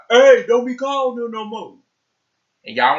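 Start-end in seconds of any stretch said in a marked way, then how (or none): 0.1-1.8 high, loud mock dad warning; imitating girl's dad. Girl's dad warning a boy off the phone, loud high mock voice. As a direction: play the dad telling a kid off, hammed up. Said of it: "He was like".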